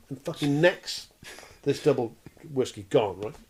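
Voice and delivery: ordinary talk, nothing marked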